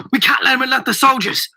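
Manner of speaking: high, urgent yell. A narrator's reading. Boy warns friends as the man escapes, yelling. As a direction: yell a warning to the others. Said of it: "I yelled".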